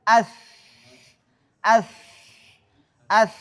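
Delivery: "As" ending in a long th as in "think"; sustained lisped hiss ending "As"; flowing tha. Shown as a demonstration. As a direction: let air run through the th closing "As". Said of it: "Apalagi huruf sai?".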